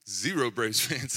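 ordinary delivery

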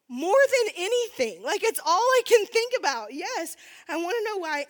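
loud, high, emphatic outburst, easing at the end; desperately eager. Retold or imitated. Answering friend who offers to explain, desperately eager. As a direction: burst out, dying to hear the answer.

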